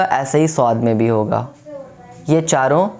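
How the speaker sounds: ordinary delivery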